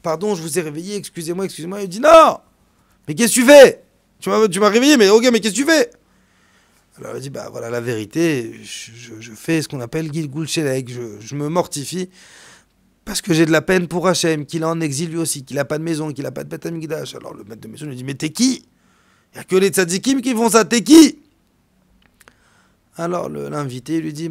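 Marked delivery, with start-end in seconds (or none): 2.0-5.9 loud, high, indignant outburst; a woken host yelling what are you doing; shouted high and loud; startled angry shout. Acted. Host woken at night, confronting guest. 18.0-21.2 demanding. Acted. Host demanding guest's identity.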